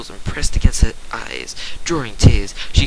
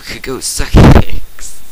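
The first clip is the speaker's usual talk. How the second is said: much louder, very slow and emphatic; slow punchline; 11 dB louder, much slower than usual. A narrator's reading. Narrator dismissing the boring family, slow loud punchline. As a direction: drag it out for the joke.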